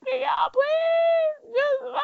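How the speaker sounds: high, slow wailing plea; hammed-up tearful begging; mock crying plea. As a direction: beg through fake tears. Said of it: "I'm literally crying".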